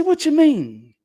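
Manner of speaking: high, loud demand; loud question. Retold or imitated. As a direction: fire it back high and loud. Pressing Junebug in retold talk.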